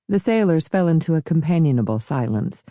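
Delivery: ordinary delivery, nothing marked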